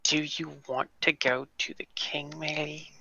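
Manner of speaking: very soft, trailing into a whisper; hushed aside. Acted. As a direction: quietly ask it, whispering the name. Player quietly asks companion in character.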